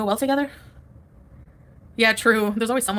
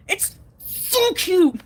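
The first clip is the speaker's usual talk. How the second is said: slow, drawn-out, much higher squeal; gushing; 9 semitones higher, 4 dB louder, much slower than usual. Real, not acted. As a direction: melt over how cute it is. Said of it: "gushing over video".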